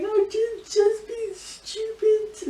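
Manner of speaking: high, mocking voice that drags out the last words; self-scolding inner voice. Vlogger mocking herself for crying, in a high, drawn-out scolding voice. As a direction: mimic the voice in your head that scolds you, very high and very slow. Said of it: "I'm like".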